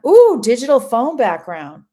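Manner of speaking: loud, high-pitched "ooh" of delight; bright idea, blurted out loud; delighted discovery. Real, not acted. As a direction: seize on the idea with sudden excitement. Sudden delight at idea just read out.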